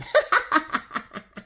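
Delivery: high, loud breathy cackle; witch cackle. A narrator's reading. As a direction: let out a wicked cackle. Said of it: "laughing at her".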